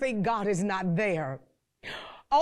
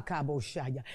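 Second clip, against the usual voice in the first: ordinary delivery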